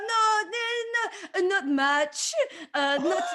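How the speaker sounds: put-on hammy French accent; English with a mock Parisian accent; french chef. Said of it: "speaking English in a French accent".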